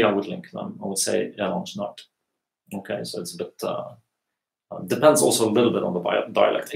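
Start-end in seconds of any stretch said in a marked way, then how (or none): 1.4-2.0 "Jeg lander snart" quick, lander and snart merged with sh; quick, linked. Shown as a demonstration. Teacher showing quick everyday speech. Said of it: "when I speak quickly".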